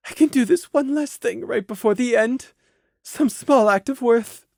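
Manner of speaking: despairing thought. Acted. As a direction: think it sadly to yourself.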